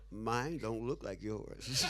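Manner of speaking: slow, laughing line; slow amused admission. Retold or imitated. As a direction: drag it out, laughing through the words. Compares his shoes, laughing.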